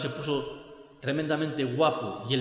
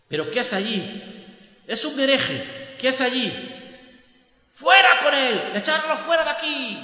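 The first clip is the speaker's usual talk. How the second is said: high, loud outraged yelling; rising to a furious shout; 9 semitones higher, 6 dB louder than usual. Acted. Singer screaming to throw out a heretic.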